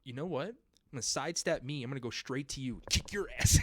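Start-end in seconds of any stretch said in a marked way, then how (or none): 2.9-3.6 higher, louder, breaking into a laugh; playful threat cracking up. Pretend. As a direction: laugh through a mock threat.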